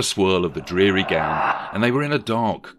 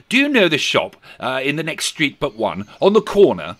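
high, animated question; eager calling out; 7 semitones higher, 7 dB louder than usual. Acted. Scrooge asking the boy about a shop, eager. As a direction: call down eagerly for directions.

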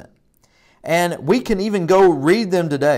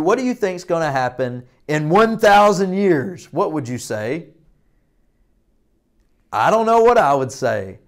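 usual speaking voice

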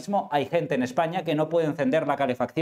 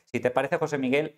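ordinary talk

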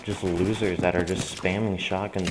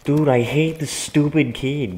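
angry; 4 semitones higher, 5 dB louder than usual. Real, not acted. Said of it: "Sorry that I'm mad".

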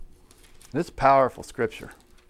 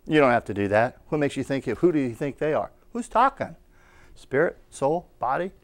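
normal talking voice

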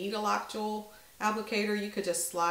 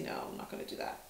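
whispers to herself, deciding against it; a breathless private aside under her breath; whispered aside; 10 dB quieter, much faster than usual. Real, not acted. Vlogger changing her mind to herself, whispered.

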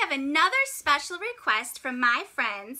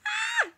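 high startled shriek; scream. Acted. Penguin spotting a shark, high shriek. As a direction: yelp in fright.

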